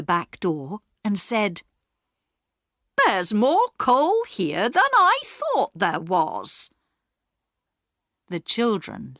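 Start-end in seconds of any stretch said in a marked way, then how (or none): 3.0-6.7 higher, slow, puzzled housekeeper; Missus Viney voiced. A narrator's reading. Housekeeper noticing the coal heap, slow and puzzled. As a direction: wonder aloud at the heap, slowly.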